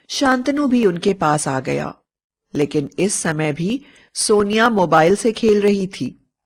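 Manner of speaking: plain reading voice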